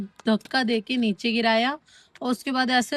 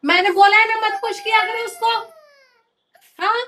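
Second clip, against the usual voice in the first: mother scolding her daughter for pushing her brother; 10 semitones higher than usual. Real, not acted. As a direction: tell her off firmly.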